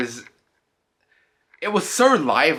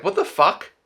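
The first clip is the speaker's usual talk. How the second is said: high mock disbelief; higher-pitched acted outburst of disbelief; shocked viewer; 8 semitones higher, 4 dB louder than usual. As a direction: imitate a baffled viewer, pitched up.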